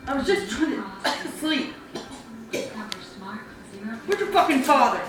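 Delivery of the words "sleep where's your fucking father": ordinary delivery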